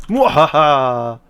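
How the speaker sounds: slow hammed-up evil cackle; sneering panto villain. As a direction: gloat like a cartoon villain. Gloating over his Mandalorians.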